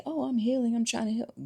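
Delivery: lightly voiced fake healer; mock self-pitying. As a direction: parody someone excusing themselves. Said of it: "and then you say".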